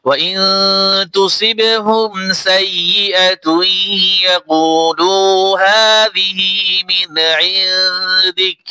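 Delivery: slow, melodic tajweed recitation; Quran recitation. Acted. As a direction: recite the full verse with tune. Recites the verse.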